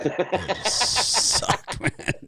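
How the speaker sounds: high squealing laugh through suck; mocking squeal. Real, not acted. Mocking the bad team.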